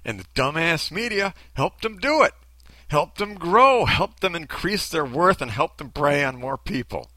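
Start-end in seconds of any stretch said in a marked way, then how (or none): none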